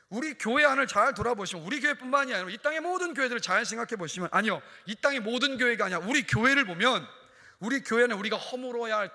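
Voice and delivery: impassioned sermon voice; forceful preaching. Real, not acted. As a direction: drive it home to the congregation.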